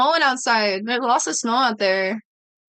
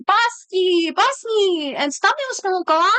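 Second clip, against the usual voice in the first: high sing-song voice for the dog; high pet-talk scolding the dog; 6 semitones higher, slower than usual. Retold or imitated. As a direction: coo the dog's name up high. Talking to her dog.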